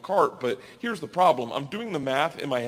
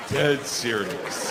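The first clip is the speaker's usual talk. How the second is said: slow, flat-faced "Dead serious"; deadpan; 4 semitones lower, much slower than usual. Retold or imitated. Bellhop confirming to the comedian, slow deadpan. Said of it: "He's like".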